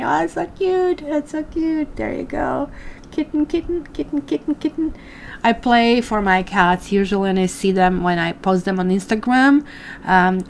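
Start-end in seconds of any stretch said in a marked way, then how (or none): 0.0-4.9 cooing in a much higher voice. Real, not acted. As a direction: coo at the kitten like a pet owner. Cooing at kitten on screen.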